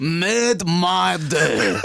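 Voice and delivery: slow, laughing mock French echo; French accent. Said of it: "That's not how we speak".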